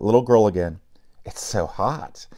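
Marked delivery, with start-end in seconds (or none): 1.2-2.3 soft, slow complaint; complaining. A narrator's reading. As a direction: whine it quietly from the heat. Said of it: "she complained".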